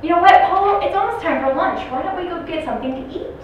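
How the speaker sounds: high, loud, upbeat redirection; coaxing staff. Acted. Staff redirect an upset resident to lunch, coaxing. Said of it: "And so they said".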